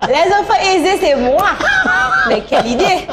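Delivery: high, incredulous laughter through the words; real amused protest, breaking into laughter; laughing disbelief. Real, not acted. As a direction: laugh off the suggestion as absurd. Laughing at the question.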